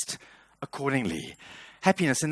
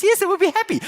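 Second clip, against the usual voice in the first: much higher, louder mock wife; pitched-up imitation of a demanding wife; wife's voice; 7 dB louder than usual. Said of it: "And she says".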